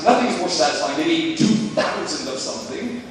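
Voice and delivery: ordinary talk, nothing marked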